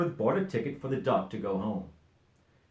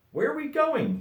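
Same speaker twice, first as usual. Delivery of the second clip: higher, louder eager question; eager duck; 5 dB louder than usual. A narrator's reading. Duck asking Dodsworth, high and eager. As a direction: ask like a kid on a trip. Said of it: "he asked".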